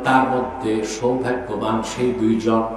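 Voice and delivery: ordinary talk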